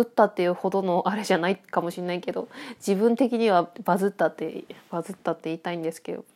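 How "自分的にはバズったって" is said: ordinary delivery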